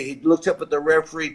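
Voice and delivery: ordinary talk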